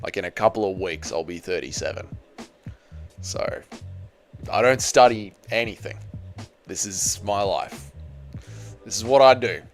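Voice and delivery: low, soft and subdued; hushed, low-pitched admission; mock-grave confession. Real, not acted. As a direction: break it to them quietly, with pauses. Mock-grave confession of his age.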